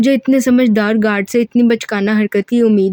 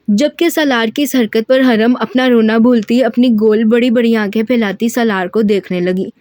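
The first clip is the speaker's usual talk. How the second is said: ordinary delivery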